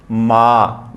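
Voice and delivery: मा stretched on a level tone; long vowel. Shown as a demonstration. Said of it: "sound बहुत long है". (मा को लंबा खींचकर)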